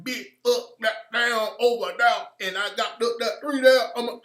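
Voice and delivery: exaggerated, garbled Southern street talk; mock Southern slang. Showing what Southern rappers don't do, mock garbled Southern accent. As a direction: mumble nonsense in a hammed-up Southern accent. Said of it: "dudes who talk with a Southern accent".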